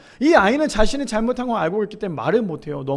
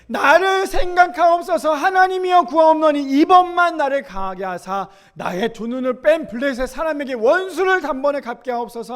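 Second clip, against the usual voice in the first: Samson crying out; 5 semitones higher, 4 dB louder than usual. Acted. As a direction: beg God for strength one last time. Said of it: "여호와께 부르짖어 이르되".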